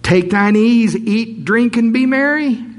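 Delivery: preacher building to a high, loud peak; full preaching. Acted. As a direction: soar up into a preacher's cry. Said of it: "I'm not supposed to preach".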